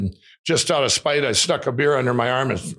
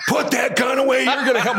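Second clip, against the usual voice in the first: much higher, half-laughing mock rage; retold furious yell; 10 semitones higher than usual. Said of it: "I just went crazy".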